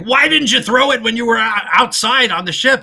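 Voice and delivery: a hint of Bud scolding Lou; mock exasperated Bud asking why. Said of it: "And Bud's like".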